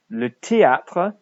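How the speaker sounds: "théâtre" said slowly, French style, tay-AH-truh; careful French théâtre. Shown as a demonstration. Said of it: "don't forget the pronunciation".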